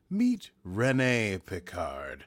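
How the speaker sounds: slow, weighty trailer-voice opener; dramatic narrator. Acted. Host opens the episode recap for listeners, slow dramatic narration. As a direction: announce it like a movie trailer.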